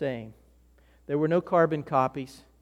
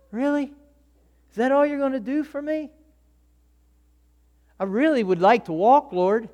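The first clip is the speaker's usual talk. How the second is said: high, incredulous let-down plea; disappointed complaint; 9 semitones higher, 4 dB louder than usual. Retold or imitated. A healed man let down, pleading with Jesus, high and incredulous. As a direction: sound let down by what you got. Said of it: "I'm like".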